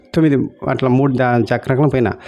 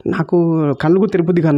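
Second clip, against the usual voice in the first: normal talking voice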